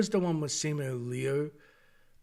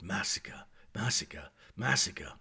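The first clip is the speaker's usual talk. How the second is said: low, hushed foreboding chant, fading into whisper; ominous muttering; 9 semitones lower, 8 dB quieter, slower than usual. Retold or imitated. Viewer hinting at Gorman's coming fate, ominous low murmur. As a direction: drop far down and softly intone it.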